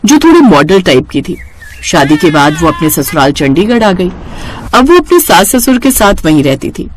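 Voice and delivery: ordinary delivery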